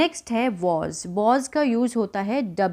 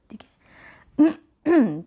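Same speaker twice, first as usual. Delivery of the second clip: whisper; 6 semitones higher, 4 dB louder, much slower than usual. Real, not acted. Whispered aside mid-lesson.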